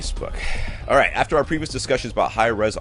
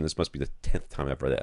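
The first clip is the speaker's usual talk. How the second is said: ordinary delivery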